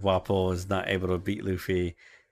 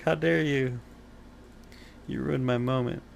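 mock offended; 4 semitones higher, 4 dB quieter than usual. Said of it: "I was like".

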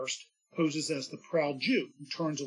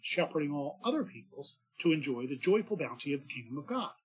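ordinary talk, nothing marked

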